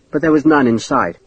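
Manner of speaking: usual speaking voice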